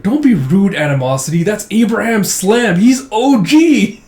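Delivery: scold, then build to gushing awe; excited admiration. Retold or imitated. Young teammate defends Abraham Slam, excitedly admiring.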